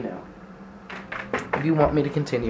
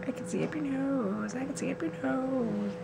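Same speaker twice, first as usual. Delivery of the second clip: very high, soft dog voice; high pet voice; 13 semitones higher, 9 dB quieter than usual. Pretend. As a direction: speak for the dog, tiny and high. Voicing the dog Luna.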